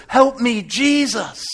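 loud, high cry for help; cried-out plea. Acted. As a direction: call it out desperately. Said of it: "I cried out".

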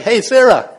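loud, cheeky taunting call; uppity. Acted. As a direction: call out to a rival, full of yourself. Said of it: "she gets a little uppity".

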